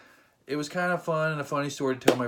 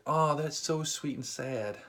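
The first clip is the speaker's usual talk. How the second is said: slow, soft mock pity; mock sympathy; 2 semitones higher, 4 dB quieter, slower than usual. Comedian mocking the couple, slow mock sympathy. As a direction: coo it with fake sympathy. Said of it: "he was like".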